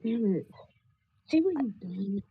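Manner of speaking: usual speaking voice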